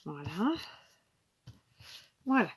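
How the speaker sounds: sniffly runny-nose voice; sniffling. Real, not acted. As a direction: keep sniffing through the words. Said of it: "Pardon, je renifle encore". (voix enrhumée qui renifle)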